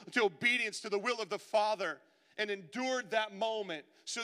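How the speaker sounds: loud, high impassioned sermon; fervent pulpit cry, slow and pleading on the quote; full-force preaching. Acted. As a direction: preach it out to the whole room.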